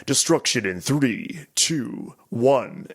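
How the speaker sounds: slow, lightly voiced narrator countdown; measured countdown with a hint of announcer. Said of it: "it's the narrator guy".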